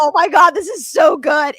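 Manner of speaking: loud delighted "oh my God"; quoted rave reaction. Retold or imitated. Retelling a friend's first taste of casserole, imitated delighted gushing. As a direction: gush over it, stretching the words. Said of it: "And she was like".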